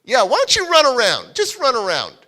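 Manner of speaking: put-on amused man egging on a cheeky boy; teasing foster dad. Foster dad daring kid to run.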